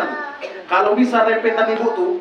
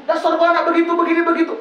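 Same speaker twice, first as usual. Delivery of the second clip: higher mock scolding; scolding mother; 7 semitones higher, faster than usual. Mother scolding her child, higher mock scolding. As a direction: snap at the child like a fed-up mother. Acted angry mother again.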